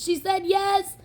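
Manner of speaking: high, joyful announcement to the crowd; proud announcement. Retold or imitated. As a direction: announce it to everyone.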